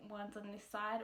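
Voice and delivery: normal talking voice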